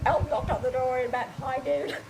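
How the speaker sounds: words breaking into laughter; laughing retold thought. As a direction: let the laugh take over by the end. Amused retelling of own startled thought.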